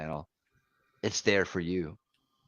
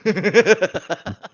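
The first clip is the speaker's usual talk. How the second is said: loud high laugh; 9 semitones higher, 7 dB louder than usual. Real, not acted. Laughing at own joke.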